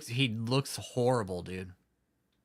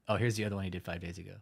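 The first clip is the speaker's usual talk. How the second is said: ordinary talk, nothing marked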